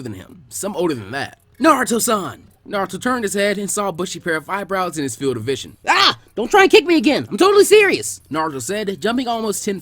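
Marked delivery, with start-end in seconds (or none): 1.6-2.5 loud eager call. Acted. Lee calling to Naruto, loud and eager. 5.9-8.2 loud, high panicked shout; startled yell. Acted. Naruto yelping at Lee, loud and high.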